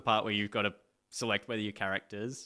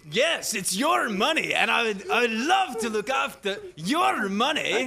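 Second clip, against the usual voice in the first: higher, louder, bellowing applicant; 11 semitones higher, 8 dB louder, slower than usual. Acted. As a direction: belt it out like a mock Scottish comic.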